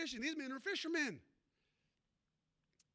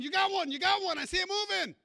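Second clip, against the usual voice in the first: much higher, louder excited yell; excited shouting; 8 semitones higher, 10 dB louder than usual. Retold or imitated. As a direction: shout like you spotted a bite. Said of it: "they're screaming".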